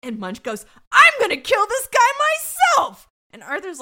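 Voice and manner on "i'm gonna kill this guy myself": high, full-volume imitated scream; hammed-up furious scream, far louder and higher; screaming threat. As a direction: yell it like a cop losing it. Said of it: "he just screams at him".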